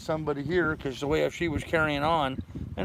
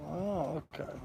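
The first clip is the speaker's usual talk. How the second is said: usual speaking voice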